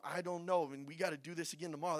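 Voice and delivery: low, soft, reluctant murmur; hushed, weary-marcher complaint; grumbling among themselves. Acted. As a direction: grumble privately about tomorrow. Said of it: "talking amongst themselves".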